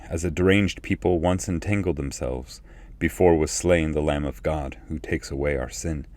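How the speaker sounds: normal talking voice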